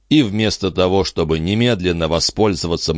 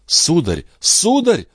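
repeated plea leaping much higher the second time; alarmed cry; 12 semitones higher, slower than usual. Acted. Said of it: "вскричала та". (испуганный вскрик, всё выше)

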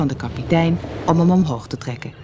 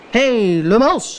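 raised call from the pirate captain; captain's call; 6 semitones higher, 3 dB louder, slower than usual. A narrator's reading. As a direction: call sharply to the crew, pitch lifted. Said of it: "werd er geroepen".

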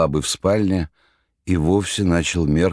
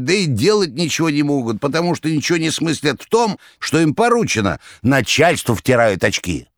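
goat voice; 9 semitones higher than usual. Acted. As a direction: sing it out like a goat. The checkered one continues.

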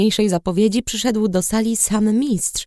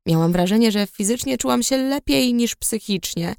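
usual speaking voice

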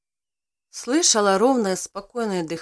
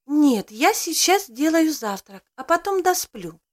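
eager, higher; 6 semitones higher than usual. Acted. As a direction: insist eagerly, pitched up. Woman eagerly getting up.

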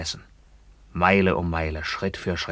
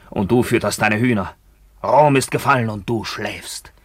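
angry reproach; 4 semitones higher, 5 dB louder than usual. Acted. Emilian reproaches the emperor.

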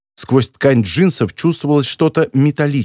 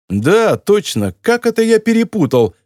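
mocking; 7 semitones higher than usual. A narrator's reading. Editor taunts the baffled captor, mocking. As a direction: feign confusion to needle him. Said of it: "насмешливо прокомментировал Бэнкрофт".

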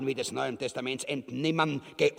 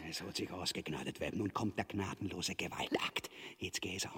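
tonlos und genüsslich geflüstert; drohendes Flüstern; 10 semitones lower, 13 dB quieter, faster than usual. Acted. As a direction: dem Opfer die Folter zuraunen. Ubu droht mit Folter. (leises, drohendes Flüstern)

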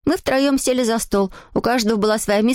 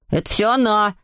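plain reading voice

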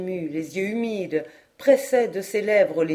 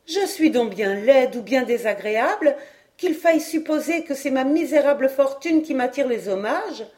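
jeune fille enjouée, voix plus haute; un reproche mutin, mi-sérieux; Hélène taquine; 6 semitones higher, 3 dB louder than usual. Acted. Hélène, vexée pour rire. (Hélène taquine Patrice, voix haute et espiègle)

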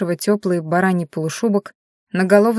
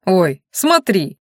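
surprised; much slower than usual. Acted. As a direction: point it out in amazement. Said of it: "удивлённо распахнул глаза".

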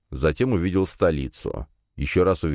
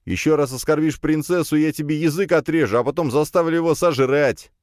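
pitched-up, forceful warning; rude threat; 10 semitones higher, 3 dB louder than usual. A narrator's reading. Hero threatening mage who insulted princess, rude and menacing. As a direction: threaten roughly on someone's behalf. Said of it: "грубо обратился я".

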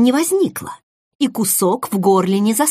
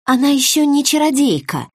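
higher-pitched, hasty reassurance; prompt assurance; 7 semitones higher than usual. A narrator's reading. Sister answering for the narrator before she can speak, assuring. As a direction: jump in to set him straight. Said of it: "немедленно уверила сестра".

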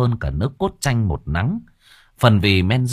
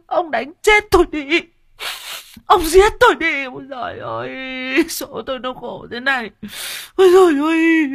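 wailing and sobbing. Acted. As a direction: wail it like a beaten wife crying out. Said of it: "khóc bù lô bù loa".

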